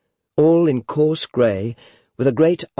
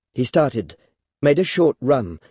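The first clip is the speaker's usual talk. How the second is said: ordinary delivery, nothing marked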